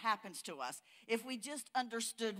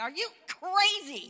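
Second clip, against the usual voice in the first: higher, louder, slow outraged yell; 10 semitones higher, 9 dB louder, slower than usual. Retold or imitated. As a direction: yell in disbelief at the news. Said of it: "I yell at it".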